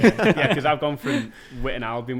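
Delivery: laughing. Real, not acted. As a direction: laugh through the answer. Laughing answer about rising fines.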